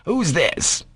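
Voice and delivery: high puzzled question; puzzled doctor. A narrator's reading. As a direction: ask who it is, baffled.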